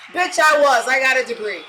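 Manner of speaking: defiant shout back; indignant comeback. Acted. Answering Skip's remark on school, indignant.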